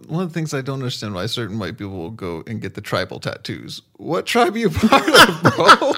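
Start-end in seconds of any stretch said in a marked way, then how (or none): 4.0-5.9 loud laughing question; mock it through laughter; laughing mockery. Real, not acted.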